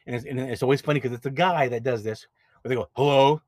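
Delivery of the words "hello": a mock gruff, suspicious hello, louder; imitating a grumpy guy answering the phone; louder mock tough-guy phone greeting; deep angry hello. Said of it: "this deep, angry voice".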